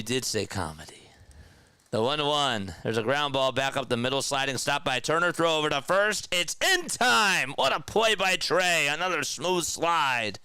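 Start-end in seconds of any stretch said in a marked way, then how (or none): 1.9-10.4 baseball call building to a high peak; rising sportscaster excitement; excited play-by-play. Real, not acted. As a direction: call the play live, pitch climbing as it unfolds. Live play-by-play of a fielding play.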